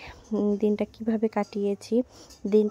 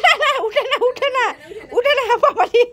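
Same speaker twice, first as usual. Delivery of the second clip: laughing protest; 13 semitones higher, 9 dB louder than usual. Real, not acted. Mother laughing as child climbs.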